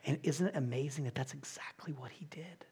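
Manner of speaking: awed whisper. Acted. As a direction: whisper the wonder of it. Awed wonder.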